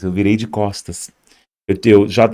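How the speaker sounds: ordinary delivery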